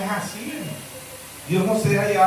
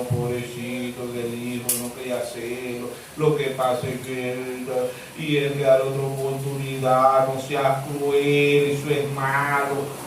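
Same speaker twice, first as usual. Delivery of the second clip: mock sentimental pity; 8 semitones lower, 5 dB quieter than usual. Mocks sentimental believers.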